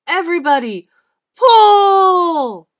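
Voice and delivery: high, very loud command, last word stretched; straining, full-voiced order; rallying shout. A narrator's reading. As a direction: holler to the team to heave. Santa orders the reindeer to heave.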